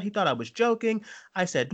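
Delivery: usual speaking voice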